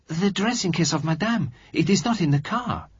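high, urgent alarmed cry; exclaiming. A narrator's reading. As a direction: call out in sudden alarm. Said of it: "she exclaimed".

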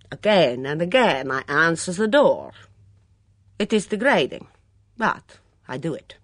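put-on Mitzi, hunted and defiant, in a heavy foreign accent; a suspicious foreign cook in a thick accent, rising to outbursts. Mitzi voiced by narrator.

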